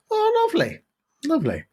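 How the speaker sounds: very high swooping lovely, falling away; vicar voice. Acted. Vicar delighted at the sale, in a very high gushing voice.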